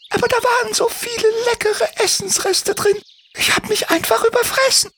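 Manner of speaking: sehr hohe, piepsige Vogelstimme; piepsig. Acted. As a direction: wie eine kleine kranke Drossel piepsen. Doris gesteht. (Drossel Doris antwortet Timmy, hoch piepsend)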